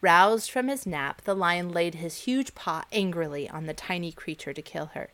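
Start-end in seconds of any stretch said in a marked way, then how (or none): none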